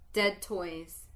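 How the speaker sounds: plain reading voice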